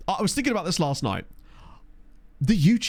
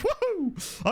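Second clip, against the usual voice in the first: gleeful whoop; 4 dB quieter than usual. Real, not acted. Cheering about plane joke.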